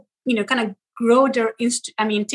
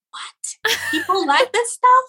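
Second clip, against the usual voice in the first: high-pitched incredulous reaction; retold disbelief; 10 semitones higher, slower than usual. Creator reacting to her video going viral, high mock disbelief. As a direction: replay your astonishment, pitch jumping up.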